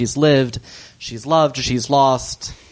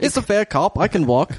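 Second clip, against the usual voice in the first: higher-pitched joke Cockney confession; Cockney accent; 6 semitones higher than usual. Pretend. Exposed colonel admitting his fakery, in mock Cockney. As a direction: own up in hammy Cockney, half laughing. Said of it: "he started speaking in a Cockney accent".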